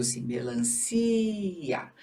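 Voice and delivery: "melancia" very slow, "ci" stretched; slowly stressed. Shown as a demonstration. Teacher settles which syllable is stressed, said slowly. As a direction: drag out "melancia" to lean on "ci". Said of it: "A sílaba tônica continua sendo".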